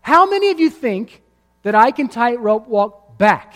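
high, loud call from far across; yelling across the canyon. Acted. Daredevil yelling back across the canyon to the crowd, high loud call. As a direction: shout back over the gap, triumphant. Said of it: "he yells back at us".

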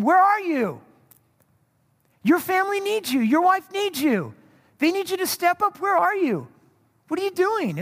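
high-pitched, pleading challenge; urgent challenge. Acted. God calls absent men to step up, urgent challenge. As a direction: call them out, each phrase rising high then falling.

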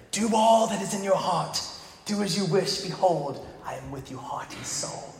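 softly spoken British armor bearer; British accent. Acted. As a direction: pledge loyalty in a hushed British accent, fading away. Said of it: "I don't know why he's British all of a sudden".

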